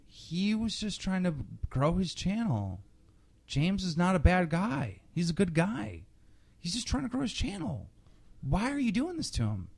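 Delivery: high, whiny mock of online commenters. Host parodying angry commenters who defend the kid, high and whiny.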